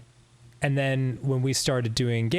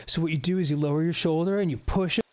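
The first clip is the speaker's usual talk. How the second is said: Chris Farley impression; 5 semitones higher than usual. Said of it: "And he's like".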